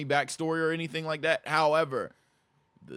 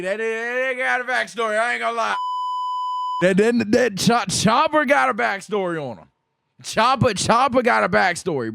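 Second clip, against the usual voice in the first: real excited outburst, pitched up; worked-up excitement; 7 semitones higher than usual. Real, not acted. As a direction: get carried away, tripping over the words. Worked up over Chopper's backstory.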